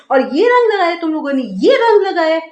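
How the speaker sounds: high, quick, outraged rant; hammed-up exasperation, pitch jumping up; acted scolding. Scolding the family for smearing colour, hammed-up outrage.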